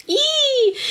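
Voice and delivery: high, playful squeal with a laugh; mock scared squeal. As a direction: squeal like a jokey ghost fright. Object fell, joking about spirits.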